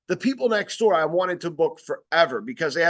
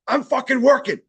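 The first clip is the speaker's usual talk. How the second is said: higher, louder mock yell of an angry lady; mock freaking out; 5 semitones higher, 4 dB louder than usual. Salesman retells the angry lady yelling at him, as a mock shout. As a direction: imitate the furious neighbor shouting. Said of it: "Like, freaking out".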